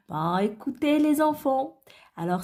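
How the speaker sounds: ordinary talk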